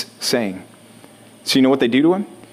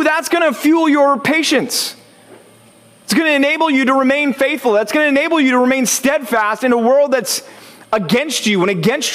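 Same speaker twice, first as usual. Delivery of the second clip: fervent preaching; 9 semitones higher than usual. Acted. Preacher urging the congregation to trust God's purpose, fervent and soaring. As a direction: drive the promise home to the congregation.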